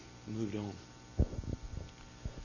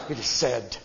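low, hushed, much softer it is said; quiet first take; 12 semitones lower, 11 dB quieter than usual. Shown as a demonstration. Preacher quoting Jesus softly to the devil, hushed. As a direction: drop it low and soft. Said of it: "S A I D".

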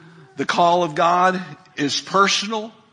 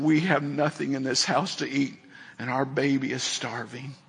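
softer, lower, sinking voice; understated retold despair; 4 semitones lower, 7 dB quieter than usual. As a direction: quote a needy parent quietly, with a touch of sadness. Father in need, retold.

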